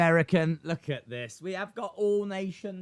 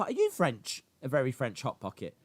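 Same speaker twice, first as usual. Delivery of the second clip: ordinary talk, nothing marked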